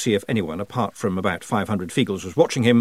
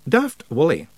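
pointed, higher-pitched address; icy patience; 7 semitones higher, 3 dB louder than usual. A narrator's reading. Rob addresses Daft Wally who interrupted, icy patience. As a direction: hold back the anger, icily patient. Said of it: "with icy patience".

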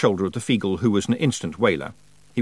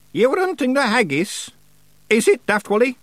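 high, sharp witch; suddenly sharp; 4 dB louder than usual. A narrator's reading. Witch turns on Daft Willie, sharp. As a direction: pounce with a high-pitched question. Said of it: "her voice suddenly sharp".

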